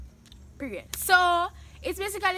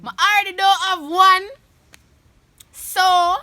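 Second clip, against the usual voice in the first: loud excited admission; 5 semitones higher, 11 dB louder than usual. Real, not acted.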